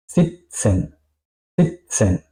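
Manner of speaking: "Sitzen" said "sit-sen", T into hissed S. Shown as a demonstration. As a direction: say "sitzen" with a crisp TS in the middle. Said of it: "como una T y una S". ("sitzen" con T y S, "sit-sen")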